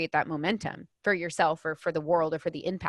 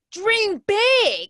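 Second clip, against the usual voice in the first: much higher, much louder shouted command; hammed-up yell of dream big; mock shout; 13 semitones higher, 9 dB louder than usual. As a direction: bellow it like a pushy motivator yelling. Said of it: "saying things louder and louder".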